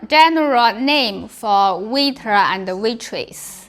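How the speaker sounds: normal talking voice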